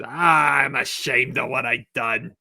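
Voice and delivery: lightly voiced, sheepish old man; a hint of comic shame; a mild put-on regret; Don Zimmer. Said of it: "hiding the newspaper from Chuck is".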